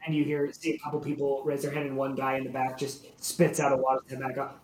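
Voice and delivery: ordinary talk, nothing marked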